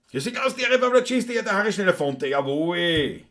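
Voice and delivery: hammed-up ringmaster-style call; mock announcement. Mock announcement, called out in long run.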